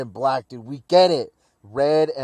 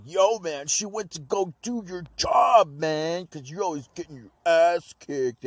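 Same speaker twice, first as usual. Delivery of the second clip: higher mock yell at Trunks; mock shout; 5 semitones higher than usual. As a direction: razz him like a rude friend. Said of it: "Yajirobe's like".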